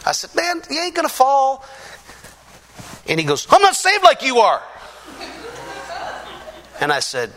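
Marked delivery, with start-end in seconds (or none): 0.3-1.6 high-pitched mocking reassurance; retold ribbing in a high voice; teasing. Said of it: "I started teasing him". 3.5-4.6 high, loud panicked protest; mock-terrified outburst, pitch way up; scared man. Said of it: "he was scared".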